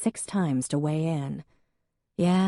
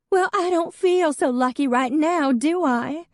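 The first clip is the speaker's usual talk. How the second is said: high and louder, sharp retort; tearful snap; 12 semitones higher, 6 dB louder than usual. Acted. Hadley snapping at Emmeline, high and tearful. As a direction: bite back through tears.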